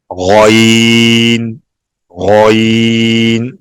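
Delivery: غین stretched slowly with a voiced gargled gh; drawn-out ghain. Shown as a demonstration. Teacher showing how the letter sounds, slow gargled gh. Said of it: "یہ غین کا sound".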